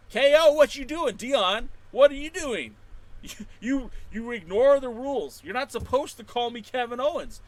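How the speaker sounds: high, loud playful scolding; mock scolding. Real, not acted. Streamer teasing a viewer for the name, mock scolding. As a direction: wag a finger at the chat.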